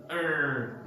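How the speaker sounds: "er" held long with a strong R; drawn-out American "er"; stressed R. Shown as a demonstration. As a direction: stretch the R in "er". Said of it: "they stress in the E R".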